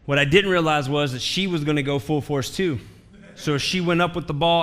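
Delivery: ordinary talk, nothing marked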